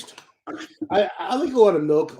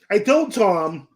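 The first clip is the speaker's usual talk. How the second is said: normal talking voice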